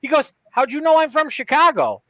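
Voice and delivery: high-pitched startled question; mock astonishment, voice way up; retold disbelief in a high voice; imitated surprise. Retelling the stranger's startled question.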